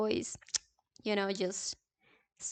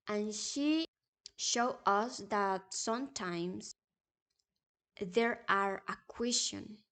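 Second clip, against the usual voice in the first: ordinary talk, nothing marked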